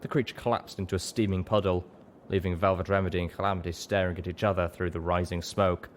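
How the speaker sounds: ordinary delivery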